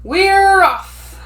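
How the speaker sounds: high and loud group cheer; crew shout. A narrator's reading. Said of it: "we all shout".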